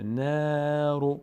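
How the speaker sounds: نارو with a long, held, unthickened aa; long, light alef. Shown as a demonstration. Teacher showing the alef is not full, long light vowel. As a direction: chant نارو slowly, keeping the n and aa light.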